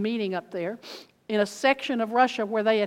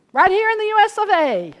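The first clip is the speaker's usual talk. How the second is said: loud, high proclamation; triumphant proclaiming; 12 semitones higher, 9 dB louder than usual. Acted. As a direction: shout it in triumph. Preaching climax.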